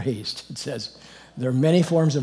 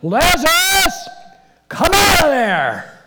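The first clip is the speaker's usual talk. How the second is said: full-force, long-drawn bellow, pitched very high; megaphone-sized shout, every word stretched; huge roar; 10 dB louder, much slower than usual. Acted. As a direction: roar it out as if to wake the dead. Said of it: "he roared with a megaphone, with a huge voice".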